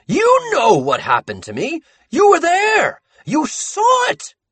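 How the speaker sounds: high, loud, manic accusing rant; a mad ink-drunk man shouting blame; unhinged Sammy ranting. Acted. Sammy shouting at Buddy that he was there.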